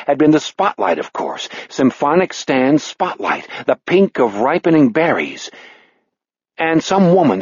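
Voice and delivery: usual speaking voice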